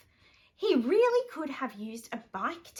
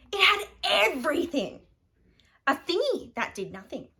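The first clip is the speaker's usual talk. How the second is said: ordinary delivery, nothing marked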